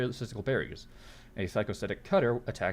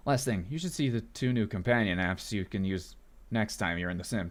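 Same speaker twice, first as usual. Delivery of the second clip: ordinary talk, nothing marked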